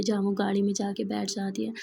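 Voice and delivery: ordinary delivery, nothing marked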